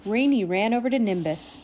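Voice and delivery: usual speaking voice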